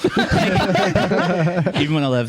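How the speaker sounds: laughing through the words, slow. Real, not acted. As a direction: laugh as you say it. Laughing while telling story.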